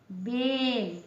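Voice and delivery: बेल with a long, held ए; ए की मात्रा का उच्चारण. Shown as a demonstration. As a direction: draw out the ए in बेल. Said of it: "ए निकला". (बेल में ए को खींचकर)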